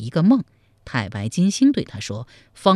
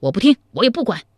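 fast, higher cut-off protest; hasty interruption; 8 semitones higher, 4 dB louder, faster than usual. Acted. General interrupting the adviser, hasty loud protest. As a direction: cut him off quickly and loud. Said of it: "忙将赵普的话打断".

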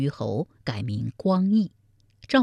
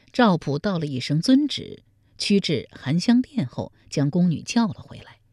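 ordinary delivery, nothing marked

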